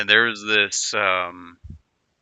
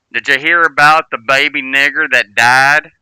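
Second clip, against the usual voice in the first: loud, higher imitated Southern old-timer; thick Southern accent; 5 semitones higher, 11 dB louder than usual. Old vet setting up his joke, loud thick Southern accent. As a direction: belt it out in a put-on Southern accent. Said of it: "had, like, an extremely thick Southern accent".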